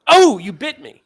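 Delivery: high, loud startled yelp; a surprised assistant crying out. Retold or imitated. Assistant yelps at patient who bit her, startled and loud. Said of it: "And the assistant says".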